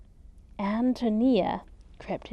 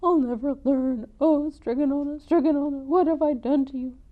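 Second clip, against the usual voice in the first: howling lament; 5 semitones higher, 7 dB louder than usual. Acted. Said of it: "howled Big Anthony".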